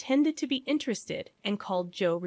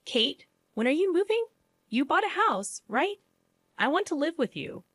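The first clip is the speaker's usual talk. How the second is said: lightly acted, high-pitched mother-in-law on the phone; raised, higher-pitched character voice; 6 semitones higher than usual. A narrator's reading. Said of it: "speaking in an unusually high-pitched voice".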